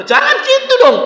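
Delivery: high, whiny plea; high pleading voice. Retold or imitated. Imitated pleading voice. (memohon dengan suara tinggi dibuat-buat)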